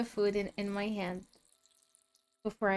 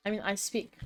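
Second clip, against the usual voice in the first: talking with a mouthful of chocolate; mouth full. Real, not acted. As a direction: say it round a bite of food. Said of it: "I eat with food in my mouth".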